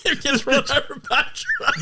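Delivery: very high, through real laughter; laughing while talking. Real, not acted. Friend retelling a funny movie gag, helpless laughing. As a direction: squeal it out while cracking up.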